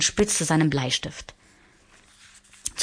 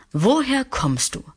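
a lightly voiced old man, pitched higher; old man's question; 9 semitones higher than usual. A narrator's reading. Old geographer asks the little prince, lightly voiced. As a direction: ask it in a put-on old voice.

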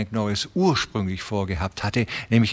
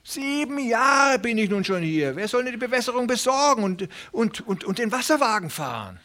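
high, hurried pleading voice of a small worried farmer; anxious little tenant; 13 semitones higher than usual. Acted. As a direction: press him nervously, voice pitched up. Reader voices the tenant Hooven. (aufgeregter kleiner Pächter, hohe, drängende Stimme)

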